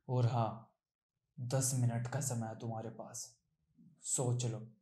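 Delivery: very low, hushed threat; quiet menace. Acted. Captor giving Preeti ten minutes to decide, quiet menace. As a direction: drop deep and soft for the ultimatum. Cold ultimatum to the captive woman.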